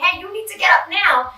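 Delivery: frustrated parent barking an order; angry command. Shown as a demonstration. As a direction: snap at the child on the floor. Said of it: "they feel our anger and our frustration".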